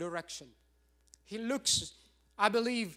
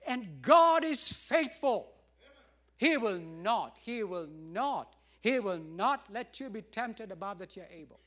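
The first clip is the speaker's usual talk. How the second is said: emphatic preaching; 4 dB louder than usual. Real, not acted.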